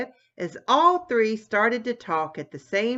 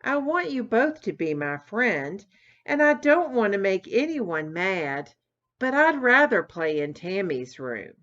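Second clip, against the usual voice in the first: ordinary delivery, nothing marked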